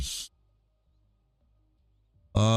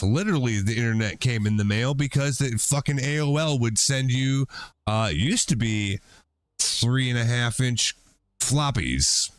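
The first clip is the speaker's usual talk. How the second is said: usual speaking voice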